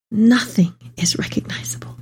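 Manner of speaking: breathless whisper of disbelief; hushed, horrified whisper; stunned whisper. Acted. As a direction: barely voice it, shattered. Devastated sight of ruined Jerusalem.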